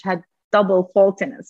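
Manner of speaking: normal talking voice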